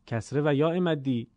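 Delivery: normal talking voice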